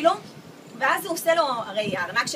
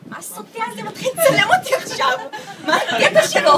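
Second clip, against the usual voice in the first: startled thought; 8 semitones higher, 7 dB louder than usual. Retold or imitated. Shocked inner thought, laughing.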